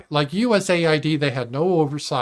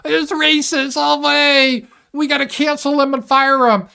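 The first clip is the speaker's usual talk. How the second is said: mock shrill outrage; 11 semitones higher, 5 dB louder than usual. Streamer mocking the cancel mob, high and loud. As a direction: parody a hysterical accuser, way up high.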